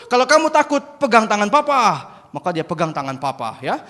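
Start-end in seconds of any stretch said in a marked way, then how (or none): 0.1-2.1 loud, raised-pitch promise; urgent father. Acted. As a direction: call it to your dangling son. Father instructs son.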